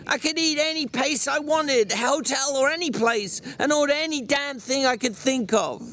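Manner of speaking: high, heated rant, pitch pushed way up; angry George. Acted. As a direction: vent the frustration at him full tilt. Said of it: "the angry George".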